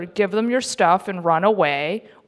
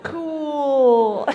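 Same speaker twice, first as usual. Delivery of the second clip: higher, slowed, ironic calm; deadpan sarcasm; 6 semitones higher, much slower than usual. Retold or imitated. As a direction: shrug it off like it's nothing. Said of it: "I'm like".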